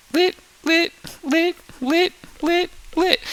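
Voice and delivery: very high, evenly spaced little blips; high sound-effect voice, like lights switching on; imitated blinking. Shown as a demonstration. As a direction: tick each word out in a high squeak. Imitates plasma lighting in series.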